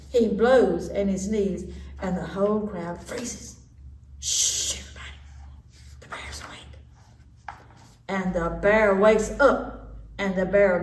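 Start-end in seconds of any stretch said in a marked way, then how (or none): 4.2-6.8 urgent hushed whisper. Acted. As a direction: warn everyone without waking the bear. Animals warn quietly.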